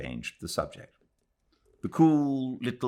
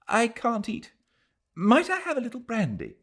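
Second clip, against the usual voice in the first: put-on high, fragile young Englishwoman; a nervous girl asking for brandy, higher voice; Adela's voice. Adela begging for brandy before the trial, high and fragile.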